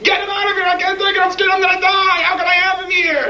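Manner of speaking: high-pitched hysterical outburst; panicked yelling. Acted. Frightened man in a bomb shelter, panicked yelling. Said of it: "someone yelled".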